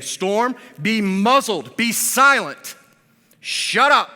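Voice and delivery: loud, high shouted "be muzzled, be silent, shut up"; harsh command. Shown as a demonstration. Pastor voicing Jesus rebuking the storm, shouting. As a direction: bark it at the storm with full authority. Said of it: "He's saying".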